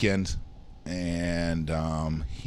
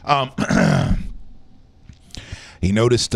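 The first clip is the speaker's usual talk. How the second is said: ordinary talk, nothing marked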